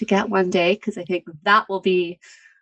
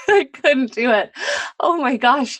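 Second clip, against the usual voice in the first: ordinary talk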